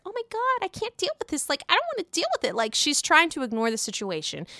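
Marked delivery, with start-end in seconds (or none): 0.1-2.6 high, whiny mock brush-off, fast at the end; mocking Ramona. Vlogger mocking Ramona's dodge, high whiny mock. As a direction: squeal it like someone dodging the topic. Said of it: "Because Ramona's like".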